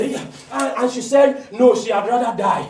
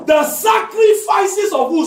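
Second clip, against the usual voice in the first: loud, higher, slow emphatic sermon; preaching at full force; 10 semitones higher, 5 dB louder, slower than usual. Acted. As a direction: drive it home with fire. Call to congregation.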